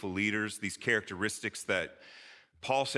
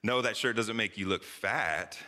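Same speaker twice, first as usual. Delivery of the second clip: FAT pitched up and stretched at the end; stress on FAT. Shown as a demonstration. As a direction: save the emphasis for the last word, "fat". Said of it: "emphasis matters".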